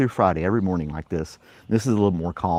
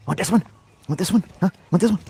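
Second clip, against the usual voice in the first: high teasing dog voice; 9 semitones higher than usual. Real, not acted.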